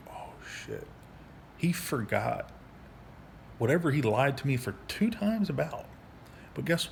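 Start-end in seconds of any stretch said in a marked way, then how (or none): 0.1-0.9 breathy under-the-breath curse; whispered aside. Real, not acted. Veteran telling his story, sudden whispered self-interruption. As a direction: drop it to a whisper as you catch yourself. Slip caught mid-rant.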